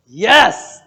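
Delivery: loud, high, celebratory yes; exultant burst; triumphant cheer. Real, not acted. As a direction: shout it in delight. Cheers a second person who saw it.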